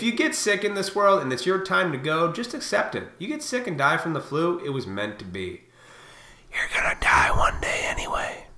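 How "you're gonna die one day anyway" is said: breathy whispered aside. Real, not acted. Telling viewers death comes anyway, whispered. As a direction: let it drop to a whisper.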